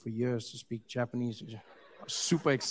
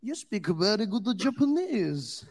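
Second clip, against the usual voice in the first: mock Japanese accent; 6 semitones higher, 4 dB louder, slower than usual. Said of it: "I said".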